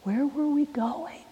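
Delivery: acted anxious whisper; hushed worry. Uneasy student wondering where the bus is taking them, hushed worry.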